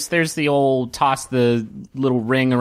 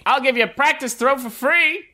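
high-pitched sales patter; enticing game vendor; 9 semitones higher than usual. Acted. Vendor urging a player to try, high coaxing patter. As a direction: coax them in like a fairground hawker. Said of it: "one particularly enticing vendor who's".

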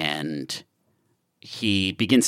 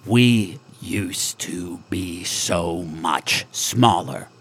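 slow, low and intent; 3 semitones lower, 3 dB quieter, much slower than usual. Acted. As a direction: lean in and say it slowly. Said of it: "says extremely intently".

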